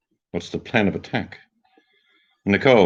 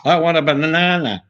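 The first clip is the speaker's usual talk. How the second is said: much higher and louder, spelling it out to a foreigner; mock Brit abroad shouting his order; loud British tourist; 9 semitones higher than usual. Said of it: "they just talk louder".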